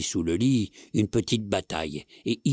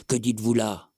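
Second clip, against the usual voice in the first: ordinary delivery, nothing marked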